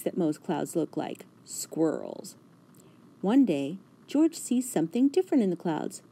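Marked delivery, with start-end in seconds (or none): none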